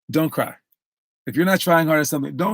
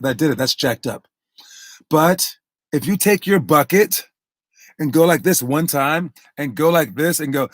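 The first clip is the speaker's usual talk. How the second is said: ordinary talk, nothing marked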